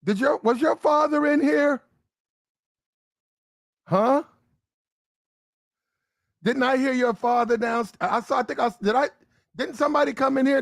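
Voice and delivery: a rattled mother tumbling over her words; panicky mom. Acted. Mom realizing the father was there, high and rushed.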